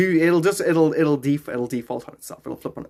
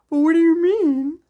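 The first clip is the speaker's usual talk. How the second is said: very high, loud, drawn-out mock innocence; playing innocent with haters; 12 semitones higher, 6 dB louder, slower than usual. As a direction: play the helpless little one, teasing. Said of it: "I like to play with them and go".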